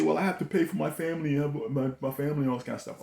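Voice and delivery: hammed-up excuse-maker; mock excuse voice. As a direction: play the person defending their paycheck. Mocking a listener's excuse.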